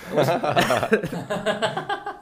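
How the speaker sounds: laughing through the punchline; cracking up. Real, not acted. Actor retelling the writer's failed line reading, cracking up.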